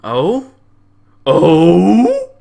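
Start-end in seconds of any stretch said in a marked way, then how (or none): 1.2-2.3 loud, high, drawn-out mock Oh; a loud put-on gasp of surprise; mock surprised oh. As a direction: imitate her startled Oh, hammed up. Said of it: "I'll bet that's how she said it".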